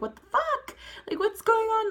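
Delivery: high-pitched, panicky re-enactment; retold freak-out. Retelling a bad high to viewers, freaking out. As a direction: act out your own freak-out, pitch leaping way up. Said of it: "I just started freaking out".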